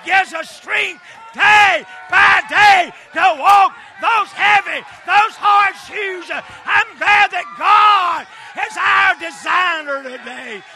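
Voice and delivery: loud rhythmic sermon cadence; preaching at full force. Acted. Preacher rousing his church about strength, full-force whooping delivery. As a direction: pound out each line to the congregation.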